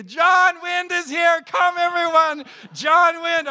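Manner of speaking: high, loud joyful calling of the name; crowd yelling. Retold or imitated. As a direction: shout it out like villagers greeting a hero. Villagers shouting over him.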